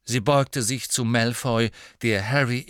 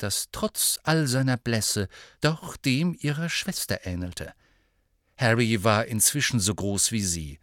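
ordinary delivery

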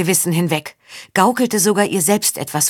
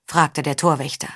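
ordinary delivery

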